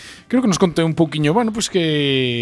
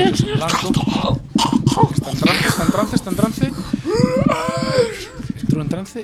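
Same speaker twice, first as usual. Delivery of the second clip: high, urgent trance alarm; excited announcement. Acted. (anuncio emocionado)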